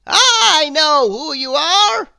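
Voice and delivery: high, loud, slow wizard voice; stern wizard. A narrator's reading. Wizard scolding Mister Mischief, stern and loud. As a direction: loom over the culprit as a stern wizard. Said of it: "said the wizard".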